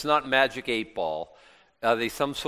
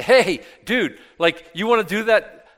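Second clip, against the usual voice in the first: casual dude voice; 7 semitones higher, 6 dB louder than usual. Retold or imitated. People voiced agreeing with Saul.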